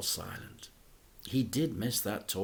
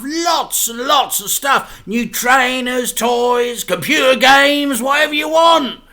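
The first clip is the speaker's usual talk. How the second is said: loud, high excited boasting; getting carried away with delight; excited dad; 12 semitones higher, 14 dB louder than usual. A narrator's reading. Dad promises his son stuff, loud and excited.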